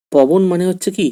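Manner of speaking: ordinary talk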